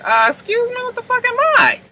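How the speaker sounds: very high-pitched affronted retort; mock indignant. Marcus balks at fetching drinks, high mock indignation. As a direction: squeak out the offended question. Marcus objects to being ordered.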